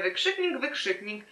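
starts high, steps down and fades; deadpan punctuation aside. Shown as a demonstration. Reader mocks the story's exclamation marks, deadpan aside.

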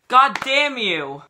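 mock outrage. Vlogger cursing clickbait, hammed-up angry outburst. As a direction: curse the clickbait with fake fury.

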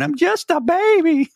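very high, squeaky cartoon baby voice; mocking a whiny man with the TikTok baby voice; tiny baby voice. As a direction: imitate the little TikTok baby. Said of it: "the little voice".

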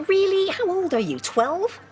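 high-pitched incredulous mockery; scornful disbelief. Mocking someone upset over a holiday, scornful disbelief. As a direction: scoff at them, pitched right up. Scoffing at the Lapland complaint.

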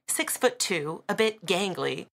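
usual speaking voice

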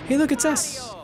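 very high little character voice; high-pitched, eager put-on voice; Luigi voice. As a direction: squeak it out as a cartoon character. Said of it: "No, that's you, Luigi".

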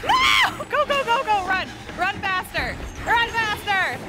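high, frantic cries to flee; panicked urging. Real, not acted. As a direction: squeal it urgently, running for your life. Fleeing the monster in a game.

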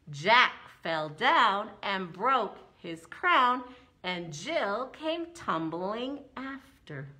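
slow, swaying rhythm on each beat; rhythmic swaying recital. Shown as a demonstration. Teacher showing the rhythm of the poem, slow swaying beat. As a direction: rock the rhyme back and forth slowly. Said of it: "when I said it the second time, I was going back and forth".

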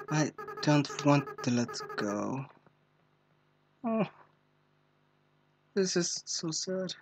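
soft, slow, choked-up; quietly moved. Real, not acted. Player choked up at Asriel's goodbye. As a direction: say it near tears.

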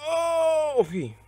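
high, loud "oh" held out; startled cry. Real, not acted. Gamer reacting mid-match, loud startled cry. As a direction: cry out long and high, then drop.

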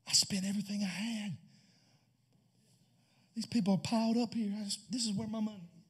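low, soft worried voice; hushed fretting. Acted. Groom's father fretting quietly about the money. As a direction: keep it hushed and anxious.

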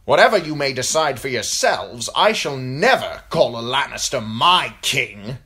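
firm, forceful vow; defiant declaration. A narrator's reading. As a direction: declare your refusal to the whole hall. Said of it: "declared Mark Piper".

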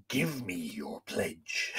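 put-on low, slow grovelly old man voice; wrong take, voiced as a gravelly old man. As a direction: growl it like a gruff old man. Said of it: "she doesn't sound like a grovelly old man".

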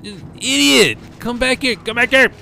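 high, loud frustrated yell at a fleeing guy; angry yelling. Real, not acted. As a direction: yell after him to come back. Yelling at game character.

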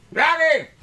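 high, loud holler; calling out. Real, not acted. Man hollering for his dog, loud high call.

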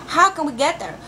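usual speaking voice

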